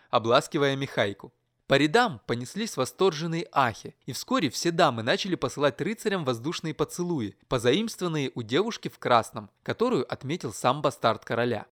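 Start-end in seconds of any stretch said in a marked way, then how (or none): none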